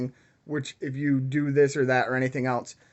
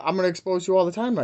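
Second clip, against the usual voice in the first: angry threat; 5 semitones higher, 3 dB louder, faster than usual. Real, not acted. Threatening the scammer.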